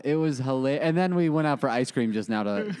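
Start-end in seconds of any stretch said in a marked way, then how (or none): none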